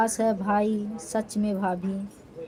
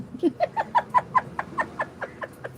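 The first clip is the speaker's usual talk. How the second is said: high giggle. Real, not acted. Laughing at her own threat.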